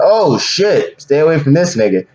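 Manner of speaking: high alarmed yelp of a stranger; startled reaction. Retold or imitated. Stranger hearing about the monster, high startled alarm. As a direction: recoil in mock alarm, voice jumping up. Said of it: "It's like".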